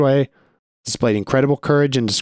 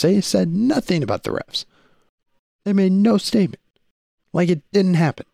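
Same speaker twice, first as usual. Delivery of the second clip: pitched way up in disbelief, real exasperation; incredulous exasperation; 11 semitones higher than usual. Real, not acted. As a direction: sound stunned that they stayed silent. Incredulous rant about Big Twelve.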